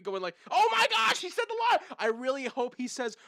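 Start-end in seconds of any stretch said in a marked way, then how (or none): 0.5-1.8 high, loud mock freak-out; excited fan scream. Fan imagining himself at the movie theater, excited screaming. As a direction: act out losing it in the cinema. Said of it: "screaming in the theaters".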